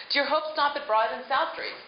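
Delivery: ordinary talk, nothing marked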